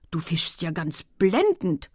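slow, amazed admiration; astonished. A narrator's reading. Husband admires his wife's catch, astonished. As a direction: marvel at it, word by word. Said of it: "rief er höchst baff".

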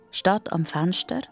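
plain reading voice

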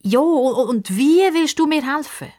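put-on girl losing patience with the crocodile; impatient. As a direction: hurry him up. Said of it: "Pippa wird ganz ungeduldig".

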